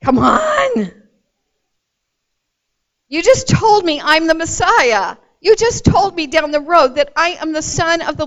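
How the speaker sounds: high, loud pleading cry; preacher's loud passionate appeal; impassioned cry. Acted. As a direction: cry out to Jesus in protest. Preacher crying out to Jesus.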